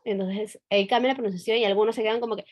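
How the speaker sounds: ordinary delivery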